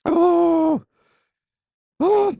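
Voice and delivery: sudden high loud cry; high pained yelp. Real, not acted. The spice kicks in mid-game, high yelp. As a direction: yelp like the burn just hit. Wing heat hits hard.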